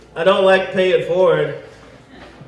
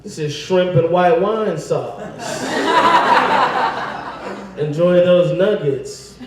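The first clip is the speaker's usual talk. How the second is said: slow smug defiance; slower than usual. Pretend. Defiant reply.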